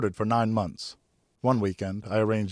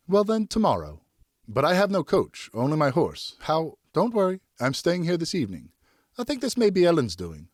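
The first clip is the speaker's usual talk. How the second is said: put-on higher, lighter young lady's voice; Geneviève's voice; 8 semitones higher, 3 dB louder than usual. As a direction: speak as a graceful young noblewoman.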